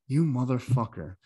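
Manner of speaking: ordinary delivery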